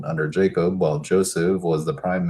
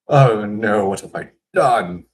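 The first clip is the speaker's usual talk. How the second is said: dismayed gasp; 2 semitones higher, 5 dB louder than usual. Acted. Isaac trembled greatly.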